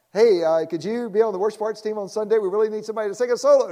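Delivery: bright, fast, loud pleading ask; eager request. Retold or imitated. Pastor calling the ER doctor, eager and loud.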